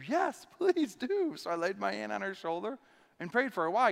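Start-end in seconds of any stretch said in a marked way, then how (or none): 0.1-1.4 higher, slow, lightly voiced old woman; a hint of a thankful lady; grateful woman. Injured woman accepting the pastor's prayer, slow and grateful.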